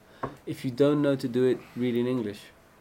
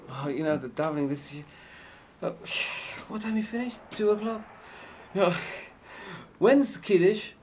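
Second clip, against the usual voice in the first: impatient congregant; 7 semitones higher, 5 dB louder than usual. Retold or imitated. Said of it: "It's not gonna be".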